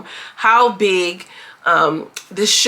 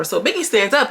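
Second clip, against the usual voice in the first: ordinary talk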